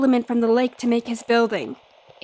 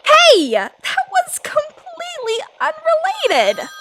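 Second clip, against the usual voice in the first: indignant outburst. Acted. Sister protesting the firecracker accusation, indignant high shout. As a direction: cry out in flustered denial.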